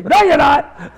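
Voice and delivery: mock-scolding shout; loud contradiction. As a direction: overrule him at full voice. Old man flatly contradicts him.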